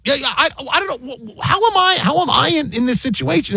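quiet, high, exasperated disbelief; baffled disbelief. Real, not acted. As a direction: ask it like you can't believe it's happening. Baffled at being blamed.

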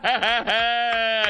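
high cackling burst; disbelieving laugh. Real, not acted. Host reacting to the candidate's denial, laughing.

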